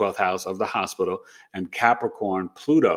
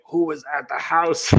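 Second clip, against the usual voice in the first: raspy quote as if through a breathing tube; through a trach tube; 5 semitones higher than usual. Retold or imitated. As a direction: imitate talking past a trach. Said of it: "I was talking through the tube".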